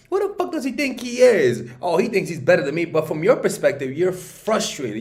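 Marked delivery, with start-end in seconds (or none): 0.1-2.8 high, loud, indignant woman's voice; offended woman. Retold or imitated. Woman offended by a guy's rejection, higher indignant voice. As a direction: scoff like an insulted woman. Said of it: "she's like".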